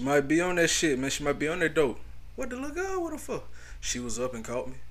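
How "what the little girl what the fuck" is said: very high soft disbelief; incredulous squeak. Real, not acted. Host shocked about the little girl, high squeak.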